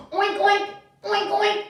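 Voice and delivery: cheerful piggy noises; pig oinks. Shown as a demonstration. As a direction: lead the children in oinking like pigs. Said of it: "Can we all oink like pigs together".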